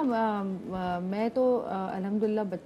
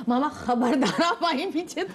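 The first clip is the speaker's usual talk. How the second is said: mock son's warning, laughing; 4 semitones higher than usual. Son warning mother, laughing.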